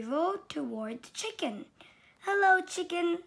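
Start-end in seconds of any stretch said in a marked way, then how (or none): 2.2-3.2 high, loud cheerful hello; cheery greeting. Acted. Duck greeting Sheep from his bike, bright and loud. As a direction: sing out a friendly hi. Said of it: "said Duck".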